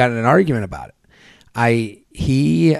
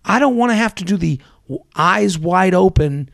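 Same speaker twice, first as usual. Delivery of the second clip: ranting retort; 6 semitones higher than usual. Retold or imitated. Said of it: "I go".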